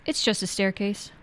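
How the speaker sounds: ordinary delivery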